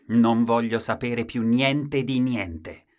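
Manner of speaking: slow, loud, final refusal. Acted. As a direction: hammer it out slowly.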